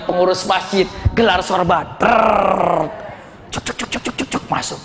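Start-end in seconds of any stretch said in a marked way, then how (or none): 2.0-2.9 long trilled "trrr"; imitated rolling sound. Mosque committee spreads a cloth for donations, trilled sound effect. 3.5-4.4 soft voiceless "cuk cuk cuk"; rapid little chucks under the breath; imitated donation sounds. Money drops into the collection cloth, soft repeated sound effect.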